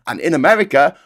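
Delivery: normal talking voice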